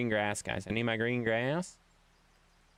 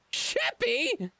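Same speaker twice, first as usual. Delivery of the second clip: much higher sing-song calling of the dog's name; "Sheppy?" sung out high and falling; high falsetto call. Real, not acted.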